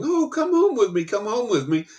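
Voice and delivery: high, sing-song puppy coaxing; high cooing baby talk to a puppy. Retold or imitated. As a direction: coo at a stray puppy you just found.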